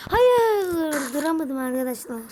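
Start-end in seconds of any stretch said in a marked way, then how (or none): 0.1-1.1 high wail of defeat; real dismayed cry; anguished cry. Real, not acted. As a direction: cry out as the run fails. Fails the level, cries out.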